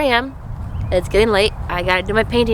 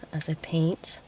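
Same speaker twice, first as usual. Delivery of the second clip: whispered; 2 semitones lower, 11 dB quieter than usual. Real, not acted. Trailing aside while painting.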